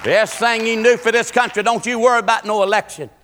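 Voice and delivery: high, loud preaching shout; full-force preaching. Real, not acted. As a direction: cry it out to the congregation like a revival preacher.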